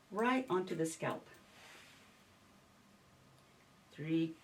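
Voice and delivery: soft, unhurried spa murmur; soothing massage murmur. Real, not acted. Quiet instructions during scalp oiling.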